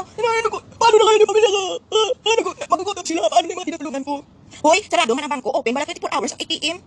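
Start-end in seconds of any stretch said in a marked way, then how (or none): 0.0-4.1 high, louder fake sobbing plea; mock crying. Parodying the actor's tearful video, hammed-up crying. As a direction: blubber it out like a staged breakdown. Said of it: "Sabi, sabihin niya".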